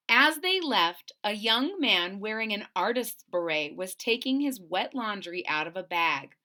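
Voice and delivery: ordinary delivery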